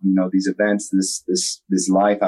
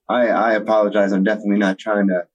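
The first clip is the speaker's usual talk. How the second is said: ordinary delivery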